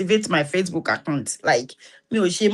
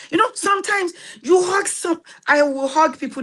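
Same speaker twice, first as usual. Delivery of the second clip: excited; 10 semitones higher than usual. Real, not acted. Excited outburst.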